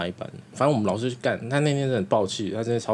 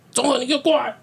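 raised, higher angry teacher call; teacher yelling; 12 semitones higher, 3 dB louder than usual. Retold or imitated. Teacher summoning a student across class, loud angry call. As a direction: call him up like a furious teacher.

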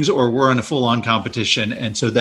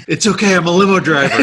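raised, louder movie-quote voice; imitated limo driver; 8 semitones higher, 5 dB louder, faster than usual. Limo driver reassuring onlookers, in a high mock call-out. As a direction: quote it high and loud, amused.

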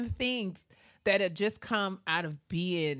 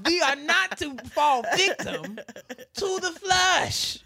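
slow, raised, drawn-out pulpit proclamation; hammed-up sermon through laughter; mock preacher; 7 semitones higher, 4 dB louder, much slower than usual. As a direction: intone it like a preacher, stretching the words. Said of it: "He would just say it".

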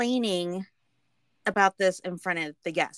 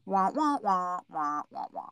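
Charlie Brown teacher drone; droning trombone-like wah wah; muffled wah wah talk; 4 semitones lower than usual. Retold or imitated. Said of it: "talking like the teacher from Charlie Brown".

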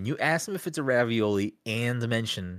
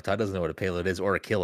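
ordinary delivery

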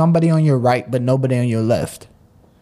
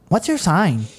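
imitating a girl; 5 semitones higher, slower than usual. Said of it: "They say".

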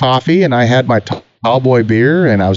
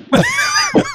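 shouted laugh; 3 dB quieter than usual. Retold or imitated. Chad laughing at him.

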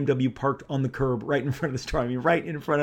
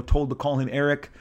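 ordinary delivery

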